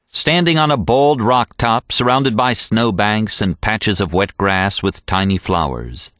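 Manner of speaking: ordinary delivery, nothing marked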